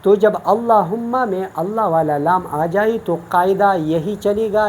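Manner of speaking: ordinary talk, nothing marked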